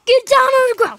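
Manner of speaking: high, loud barked order; shouted command. Acted. Boy tackling Woody toy, loud high command. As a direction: yell it as you tackle him.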